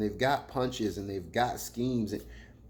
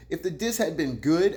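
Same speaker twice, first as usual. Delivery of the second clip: ordinary delivery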